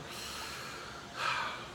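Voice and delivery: long, very soft exhale; long sigh. Acted. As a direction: breathe out slowly and quietly. Actor sighs after parting with Annie.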